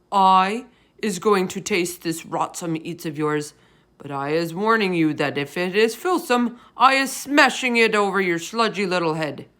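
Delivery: loud, gruff giant threatening the BFG; blood bottler voice. A narrator's reading. Bloodbottler threatening the BFG over the vegetable, in a loud giant voice.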